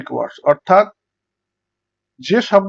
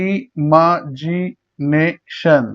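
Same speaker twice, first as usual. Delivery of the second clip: Imagination very slowly in five beats; syllable by syllable; much slower than usual. Shown as a demonstration. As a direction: pause between each syllable of imagination. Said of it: "পাঁচবার উচ্চারণ হচ্ছে".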